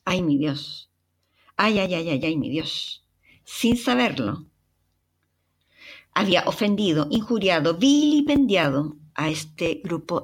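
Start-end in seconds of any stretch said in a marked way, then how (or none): none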